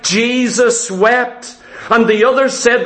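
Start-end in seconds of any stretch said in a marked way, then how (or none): 0.0-1.6 slow and solemn. Real, not acted. Preacher quotes the shortest verse.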